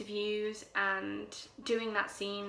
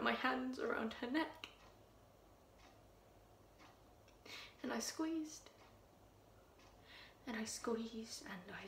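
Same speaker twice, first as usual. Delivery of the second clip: Louise, hushed and menacing; 2 semitones higher, 6 dB quieter than usual. Acted. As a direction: draw them in with a chilling hush. Louise quietly recounts strangling the cat.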